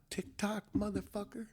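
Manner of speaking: high-pitched, quiet movie-line imitation; Samuel L. Jackson. Hinting at an actor's name for the co-host, quietly imitating him. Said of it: "basically coined the word motherfucker".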